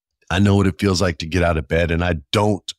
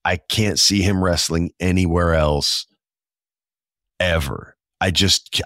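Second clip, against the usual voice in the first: usual speaking voice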